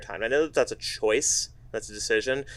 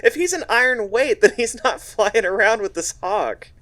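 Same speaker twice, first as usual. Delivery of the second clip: laughing; 10 semitones higher, 4 dB louder than usual. Real, not acted. Laughing joke about iron weight.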